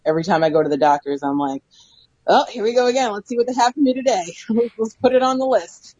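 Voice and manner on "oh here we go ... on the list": higher, singsong here-we-go-again voice; lightly hammed-up weariness; mock resignation. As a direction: act out wry expectation of bad news. Acts out usual doctor visit attitude.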